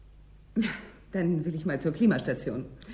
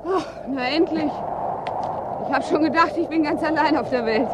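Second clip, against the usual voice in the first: raised, higher voice over the wind; shouting over storm; 7 semitones higher than usual. Acted. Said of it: "Man versteht ja sein eigenes Wort kaum".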